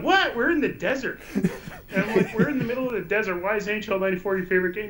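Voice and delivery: fast, high, laughing through the words; replaying his shock at the clerk, laughing and fast; laughing disbelief.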